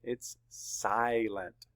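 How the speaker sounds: SILENT very slowly split SY-lent; open syllable. Shown as a demonstration. Teacher confirms the split for students, SILENT in slow syllables. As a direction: draw out SILENT as SY...lent, long "i". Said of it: "That's an open syllable".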